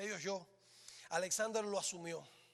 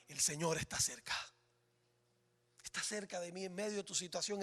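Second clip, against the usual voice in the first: "Señor" dropped low and soft, lower and softer; quiet confession; 5 semitones lower, 5 dB quieter than usual. Acted. Believer telling himself God is near, soft and low. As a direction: say it like a private assurance to yourself.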